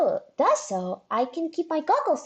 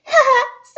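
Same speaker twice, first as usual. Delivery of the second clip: bright burst of laughter; 13 semitones higher, 9 dB louder than usual. Real, not acted. Laughing at the glove mishap.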